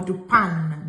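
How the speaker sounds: "pain" said like "pan", N pronounced; mispronounced "pain" with an English-like "an"; wrong sounded N. Shown as a demonstration. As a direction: drop low and end "pain" on a full N.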